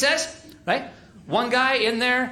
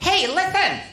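high, sharp call for attention; wife's voice; 11 semitones higher than usual. Retold or imitated. Wife calling her husband over, high demanding voice. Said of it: "This is exactly what she sounds like".

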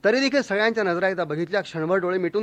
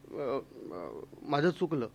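much softer, hesitant, lower mumble; sheepish apology; 5 semitones lower, 10 dB quieter, slower than usual. Retold or imitated. Husband admits fault to his angry wife, meek and much softer. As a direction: back down meekly, voice shrinking.

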